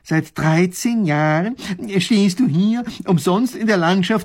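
loud, rising disbelief, slow start; incredulous. A narrator's reading. Professor to the soldier, high and incredulous. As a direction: ask it unable to believe it. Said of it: "will es immer noch nicht glauben".

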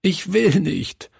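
put-on high, pleading child's sob; a frightened boy begging through a mild sob; sobbing. A narrator's reading. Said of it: "schluchzte er".

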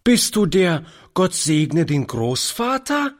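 put-on high, eager child's question; lively little girl. A narrator's reading. Little girl questions her newfound grandfather, lively and high. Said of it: "rief die Kleine lebhaft".

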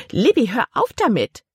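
sharp, high-pitched order; commanding. A narrator's reading. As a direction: order it as a cross aunt, pitch jumping. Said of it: "befahl ihre Tante".

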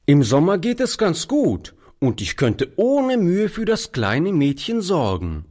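high-voiced, decided boy; determined. A narrator's reading. Street boy offers to care for the girl, determined. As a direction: assure him with mild firmness. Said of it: "erklärte der Junge bestimmt".

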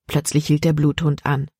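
plain reading voice

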